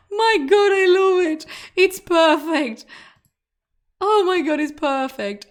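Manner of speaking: high, loud delighted squeal; thrilled delight. Real, not acted. Streamer gushing over a viewer's answer, excited squeal. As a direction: gush over it like it's the best thing ever.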